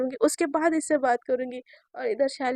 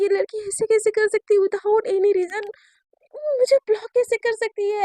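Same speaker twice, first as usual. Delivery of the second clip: indignant outburst; 6 semitones higher, 5 dB louder than usual. Acted. Shaili outraged at being blocked.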